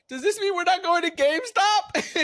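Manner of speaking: put-on high, loud, childish question; naive kid. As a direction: ask like an oblivious kid. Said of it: "and then I tell her".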